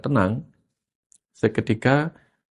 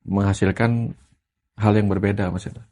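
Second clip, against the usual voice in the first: ordinary delivery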